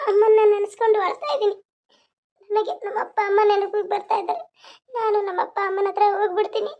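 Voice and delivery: high, tearful sobbing voice, breaking on the words; crying. Acted. Akka crying to her sister about missing her parents. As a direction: weep out the longing for her parents.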